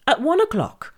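usual speaking voice

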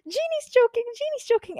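very high-pitched mimicked scream; retold panicked scream. Friend interrupts class to report the dog choking, mimicked high scream. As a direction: imitate someone screaming for help in alarm. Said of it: "comes downstairs and screams".

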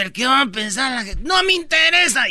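Janet imitada, más aguda al final; voz de Janet. Retold or imitated. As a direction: suelta que no le importa la gente. (imitación de Janet desafiante)

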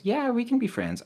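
high, eager mock voice; mock nice guy. Reading the guy's reply, high mock voice. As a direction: chirp it up like him. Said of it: "And then he goes".

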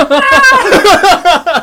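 loud, high burst of laughter. Real, not acted. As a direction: crack up loudly. Laughing at the result.

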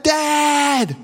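high, drawn-out mock yell; boy hollering for his father, re-enacted; imitated yell back. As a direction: call back across a distance, held in volume. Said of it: "And I yelled back".

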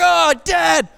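mock whine to a parent; complaining kid. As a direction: re-enact a disappointed child's appeal. Child complains to dad in story.

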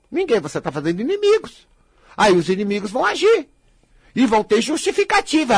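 usual speaking voice

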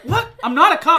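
high, loud protest; indignant denial. Retold or imitated. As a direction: shout it in disbelief. Kid denies it loudly.

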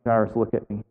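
soft, tender voice of Jesus; calm him down gently; gentle reassurance. Retold or imitated. Jesus reassuring grieving Jairus, soft and tender. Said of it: "You can imagine him going".

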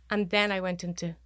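ordinary talk

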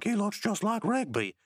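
high put-on cartoon voice; Pops. Onlooker meeting a friend's brother, high mock wonder. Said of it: "Pops is like".

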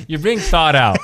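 chuckling through the words; laughing. Real, not acted. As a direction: laugh while you say it. Laughing at the word mix-up.

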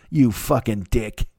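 normal talking voice

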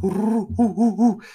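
high owl hoots. Pretend. Host hooting for the owl round, high owl call. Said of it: "okay, so owl".

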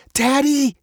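high-pitched cry for dad; frightened cry. A narrator's reading. Girl cries to her dad as lava rises, high alarm. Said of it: "cried Veronica".

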